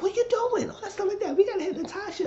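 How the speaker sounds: very high squeaky alarmed voice; squeaky Natasha voice. Retold or imitated. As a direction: pitch way up like a panicky friend. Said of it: "And Natasha said".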